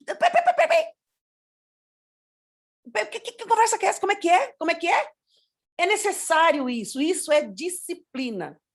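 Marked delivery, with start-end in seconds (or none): 0.0-5.1 high, rapid-fire interrupting stammer; imitated parent pulling a child up short; parental challenge. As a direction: cut in sharply with rising questions. Models parent challenging shouting child.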